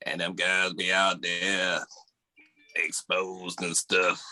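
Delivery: low, slow, droning robot preacher; robot-voiced pastor. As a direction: imitate a pastor talking through a voice box. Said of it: "be sounding like a robot with his voice".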